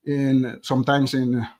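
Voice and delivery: usual speaking voice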